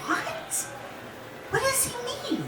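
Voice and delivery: very high, small, soft voice; baffled. Retold or imitated. Patient answering the doctor's news, baffled. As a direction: squeak it out softly in confusion.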